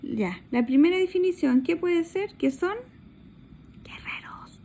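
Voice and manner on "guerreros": whispered hint; soft unvoiced whisper. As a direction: whisper the answer like a secret. Teacher whispers answer.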